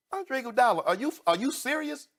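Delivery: high-pitched call-out; are-you-serious outrage; indignant disbelief. Real, not acted. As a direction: confront him directly by name. Calling out Iguodala in outrage.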